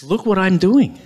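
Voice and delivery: high, lightly acted thrill; mock amazement. Simon sees himself on the water, lightly acted amazement. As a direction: play a touch of showing off.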